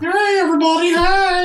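very high-pitched comic call to a crowd; mock over-eager high holler; hammed-up greeting. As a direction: squeal out a big hello. Acting out the big group greeting.

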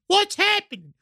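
high-pitched alarmed questions; hammed-up alarm without extra volume; mock panic. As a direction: act the panicked passenger, pitch jumping up. Acting panicked passenger questioning driver.

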